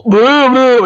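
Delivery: high hooting boo, brain dead; dopey moan. Retold or imitated. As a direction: moan it out like a dazed engine. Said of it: "he's all".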